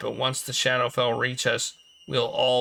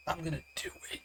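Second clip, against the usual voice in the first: whisper; 6 semitones higher, 12 dB quieter, much faster than usual. Acted. Player whispering mischievous decision.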